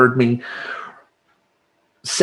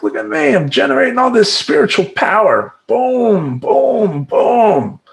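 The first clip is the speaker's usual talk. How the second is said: put-on much higher, old lady praying the rosary; little old lady; 10 semitones higher than usual. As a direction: squeak it out like a pious granny. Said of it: "a little old lady saying the rosary".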